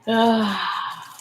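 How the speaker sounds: high sigh trailing off; drawn-out "ah" of a sigh; long sigh. Real, not acted. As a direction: let out a long, voiced sigh. Wistful sigh before a regret.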